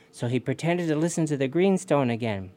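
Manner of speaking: ordinary delivery, nothing marked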